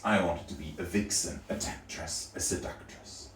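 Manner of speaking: hushed, breathy seductress whisper; sultry whisper. Retold or imitated. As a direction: purr it like a temptress. Fantasy of being a vixen.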